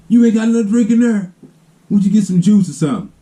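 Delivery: high, indignant refusal, imitating an uncle; mock mad uncle. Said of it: "he get mad with that".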